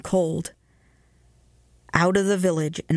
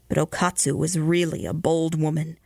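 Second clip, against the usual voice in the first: ordinary delivery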